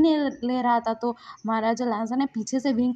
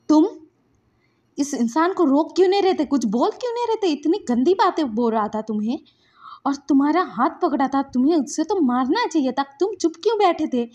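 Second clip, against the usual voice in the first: loud, higher-pitched reproach; angry scolding; 5 semitones higher, 5 dB louder than usual. Acted. Wing scolds Lansan for staying silent, angry. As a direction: fire exasperated questions at them. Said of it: "उसने गुस्सा कर दिया है".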